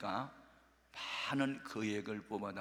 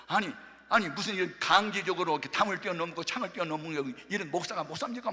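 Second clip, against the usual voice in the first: raised, defiant retort, a little louder; talking back; 5 semitones higher, 5 dB louder than usual. Retold or imitated. Woman snapping back at the pastor, defiant and louder. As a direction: push back at the accuser, half acted. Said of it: "막 뭐 대드러운 거예요".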